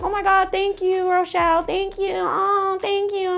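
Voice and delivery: high-pitched imitation of gushing thanks; mock gushing. Mimicking friends thanking a bridesmaid for gifts, mock gushing. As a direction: gush it mockingly, way up high.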